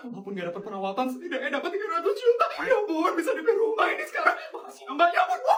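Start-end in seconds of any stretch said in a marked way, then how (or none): 1.1-5.6 giddy glee. Acted. Gleeful over three hundred million.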